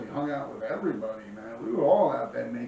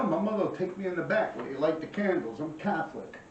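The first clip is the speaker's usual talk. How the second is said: ordinary delivery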